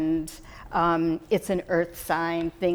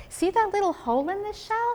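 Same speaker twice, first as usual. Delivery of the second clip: higher, animated; 12 semitones higher than usual. Retold or imitated. Showing the girl a shell, much higher and playful. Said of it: "I said".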